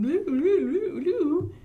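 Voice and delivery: playful silly blubbering; mock a goofy face with your lips; silly raspberry noise. Teacher showing kids a silly pumpkin face, blubbering.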